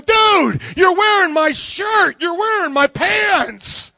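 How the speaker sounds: high, loud mock protest; hammed-up incredulous shout, stretched at the end; exasperated retort. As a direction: yell it in comic disbelief. Acted exasperated retort to ungrateful guest.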